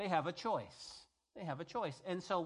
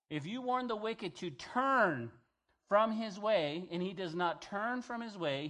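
scripture reading; 4 dB louder than usual. Acted. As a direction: lean on each phrase of the warning. Reads scripture aloud.

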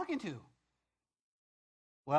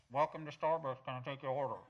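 tinny order box voice; like a drive-thru speaker box; 4 semitones lower, faster than usual. Retold or imitated.

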